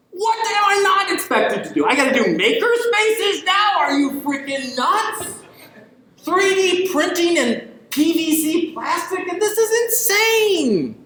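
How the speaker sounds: high, slow, mocking overwhelmed librarian voice; exasperated librarian. Overworked librarian to the lecture audience, incredulous high mock complaint. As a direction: play the librarian who can't believe her new duties. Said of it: "I see librarians that sit there and go".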